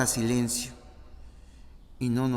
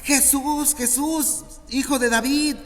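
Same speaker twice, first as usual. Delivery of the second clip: high, loud desperate calling out; frantic calls to Jesus; wild shouting; 12 semitones higher, 4 dB louder than usual. Acted. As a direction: scream his name across the crowd. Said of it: "con gritos desaforados".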